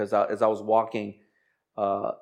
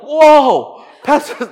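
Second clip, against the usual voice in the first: very high, loud startled "Whoa, Pastor"; 13 semitones higher, 12 dB louder, much slower than usual. Retold or imitated. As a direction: jump at the tap, high and loud. Startled church member.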